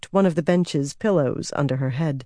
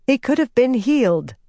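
higher, insistent and frustrated; 8 semitones higher than usual. Acted. Nynaeve to Rand about the boy, frustrated insistence. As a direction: insist it, pitch rising with frustration.

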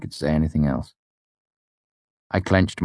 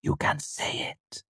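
whispered, slow and tender; hushed slow whisper; soft whisper; 8 dB quieter than usual. A narrator's reading. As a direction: breathe the words to him. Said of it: "Auri said softly".

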